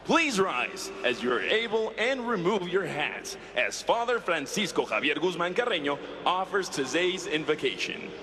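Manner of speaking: usual speaking voice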